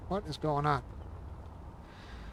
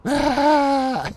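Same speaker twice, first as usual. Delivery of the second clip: high, loud startled cry; startled cry at the game; startled yell; 10 semitones higher, 9 dB louder than usual. Real, not acted. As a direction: yelp out in surprise. Sudden outburst in game.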